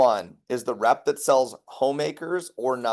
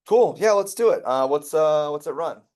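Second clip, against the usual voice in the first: high, louder, hammed-up eagerness; eager husband; 4 semitones higher, 4 dB louder than usual. Husband keen to buy knives, mock eager and high. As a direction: act out the husband jumping at the idea.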